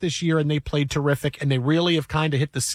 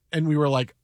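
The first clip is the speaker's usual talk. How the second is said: normal talking voice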